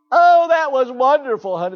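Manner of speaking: high, loud gushing parent voice; over-the-top proud parent praise. Retold or imitated. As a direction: coo it like a doting softball mom. Mocking doting softball parents.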